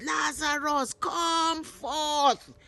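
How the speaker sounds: a big, high, carrying summons; commanding shout. A narrator's reading. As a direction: call the dead man out of the tomb. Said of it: "He shouted".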